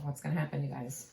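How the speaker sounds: plain reading voice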